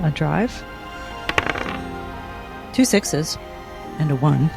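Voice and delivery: usual speaking voice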